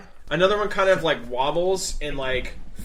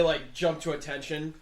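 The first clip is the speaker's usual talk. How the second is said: ordinary talk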